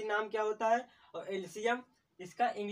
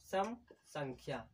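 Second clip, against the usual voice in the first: slow dictation; 5 semitones lower, 6 dB quieter, slower than usual. Shown as a demonstration. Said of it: "सम संख्या किसे कहते हैं?".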